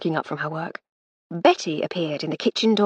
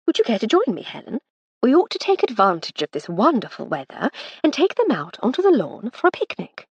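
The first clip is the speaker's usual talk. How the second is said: high, bright-pitched lady's voice, louder; Lady Hardcastle; 6 semitones higher, 4 dB louder than usual. Acted. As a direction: brush it aside as the lady of the house. Lady Hardcastle's voice, long run.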